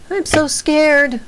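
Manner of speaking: put-on high, slow frightened child's voice; scared little girl. Girl locked in the dark room calls out, high and slow. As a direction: draw out the fear like a small child. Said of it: "That's Melissa's voice".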